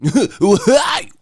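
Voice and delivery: very high, slow, prim broadcaster; mocking a too-clean boxing promo; square TV announcer. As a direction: announce it stiff and proper like a square sportscaster. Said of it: "Y'all want me to sound like that?".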